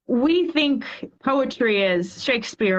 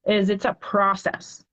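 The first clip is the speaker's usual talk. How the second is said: usual speaking voice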